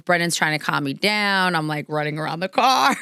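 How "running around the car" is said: ordinary delivery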